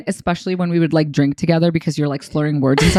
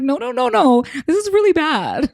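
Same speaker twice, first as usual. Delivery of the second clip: high-pitched, playful dismay; mock alarm; 10 semitones higher than usual. Host reacting to a reality couple's bad habits, mock alarm. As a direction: protest in a much higher, half-laughing voice.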